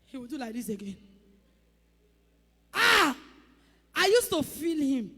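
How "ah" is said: sudden loud gasp of awe; loud amazed cry; loud awed exclamation. Real, not acted. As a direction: burst out in awe. Awe at the Undertaker.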